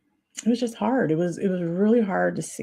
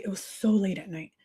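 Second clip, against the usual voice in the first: ordinary talk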